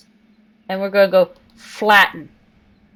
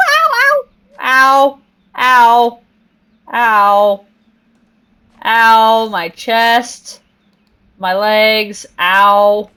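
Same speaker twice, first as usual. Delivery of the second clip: loud hammed-up yelps of pain; mock pain; 2 semitones higher, 7 dB louder, much slower than usual. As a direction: yelp as if you just fell. I let go of my climb.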